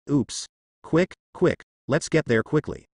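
urgent, rising panic on quick; hurried and alarmed, pushing everyone on; anxious. A narrator's reading. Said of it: "his tone was extremely anxious".

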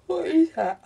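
words stretched through a real yawn. Real, not acted. Unboxing a craft swap, yawning mid-question. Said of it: "Oh, excuse my yawning".

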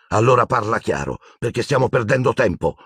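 yelled. A narrator's reading. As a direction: cut her short in exasperation. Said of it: "urlò Harry". (urlo contenuto, spazientito)

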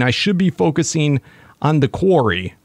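ordinary delivery

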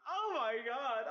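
laughing. Real, not acted. Laughs at a message.

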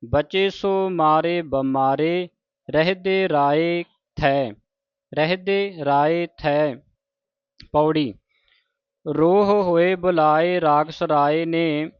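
measured, chanted reading of sacred verse; scripture recitation. Shown as a demonstration. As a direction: recite each line deliberately, with pauses.

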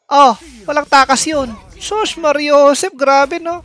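excited. Real, not acted. Hype after triple kill.

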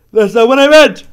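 high loud outcry; exasperated shout. Real, not acted. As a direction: cry out in frustration. Exasperated cry.

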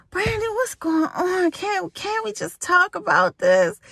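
high, whiny imitation of a pleading man; mock pleading.

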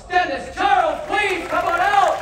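loud summons to the volunteer; announcer call. Acted. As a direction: bellow him onto the stage. Calling the volunteer out.

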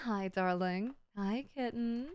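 soft, gentle greeting; soft cooing. Real, not acted. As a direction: coo it tenderly.

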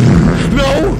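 loud, high scream of refusal; real high-pitched panic; panicked cry. Real, not acted. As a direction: cry out in sudden fright. Scared by the game.

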